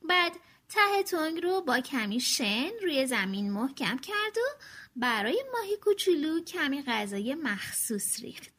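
ordinary delivery

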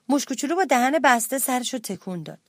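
plain reading voice

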